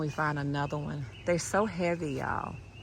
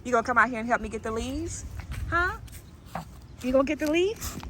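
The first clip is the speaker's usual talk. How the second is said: higher, fast teasing chatter to the dog; playful pet voice; 8 semitones higher than usual. Real, not acted. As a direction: tease the dog in a high playful voice.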